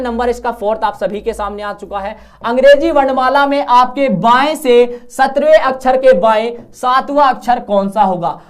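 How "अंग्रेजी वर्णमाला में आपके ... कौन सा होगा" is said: loud, projected question reading. Acted. Teacher reads out question four to students, loud and projected. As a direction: announce the question to the whole class.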